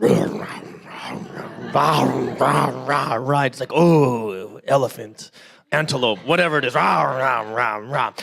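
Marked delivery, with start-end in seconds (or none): 0.0-3.4 mock tiger roar. Tiger roaring in story. 3.7-8.1 mock tiger growl. Tiger voiced in story.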